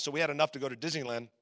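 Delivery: normal talking voice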